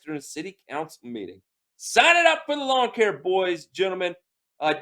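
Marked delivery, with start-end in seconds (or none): 1.8-4.1 high, loud, gleeful sign-me-up; joking eagerness. Real, not acted. Host joking about grabbing the lawn contracts, high and gleeful. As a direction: pitch it like a thrilled salesman.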